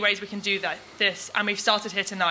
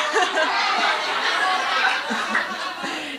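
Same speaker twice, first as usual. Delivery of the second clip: breathy laugh; 7 semitones higher than usual. Real, not acted. Laughter dying down.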